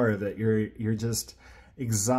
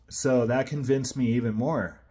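usual speaking voice

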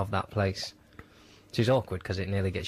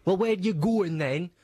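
high, slow mocking driver voice; delighted rude driver; 8 semitones higher, slower than usual. Bus driver to passengers with bags, slow gloating voice. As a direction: relish every word, drawn out and pitched up. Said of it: "he took great delight every time".

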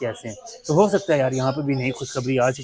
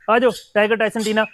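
calling out; 6 semitones higher than usual. Real, not acted. Calling pets out of the coop.

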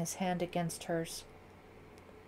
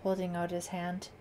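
ordinary delivery, nothing marked

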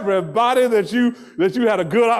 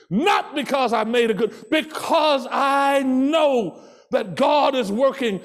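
rising, shouting sermon voice; preaching; 4 semitones higher than usual. Acted. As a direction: preach it out with full force. Preaching at full force.

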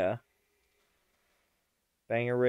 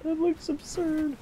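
squeaky voice; 13 semitones higher, 6 dB quieter than usual. Pretend. High put-on voice.

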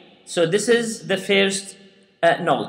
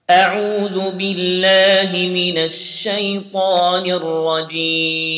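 Quran recitation; faster than usual. Shown as a demonstration. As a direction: recite it melodically like in the mosque. Recites opening formulas.

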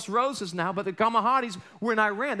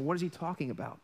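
ordinary delivery

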